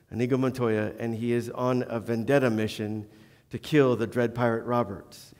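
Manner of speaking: ordinary talk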